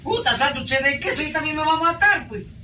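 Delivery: put-on high, sing-song Pasto accent; pastuso accent. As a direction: play up a high Pasto twang. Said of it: "Y el pastucito al lado". (acento pastuso agudo y cantado)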